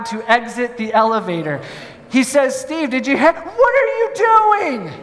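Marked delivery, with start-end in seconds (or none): 2.6-4.9 high, alarmed mock panic, stretched; panicky friend. Nervous friend sees Steve climbing out, high alarmed panic. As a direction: play the nervous friend losing it.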